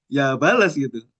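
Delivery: normal talking voice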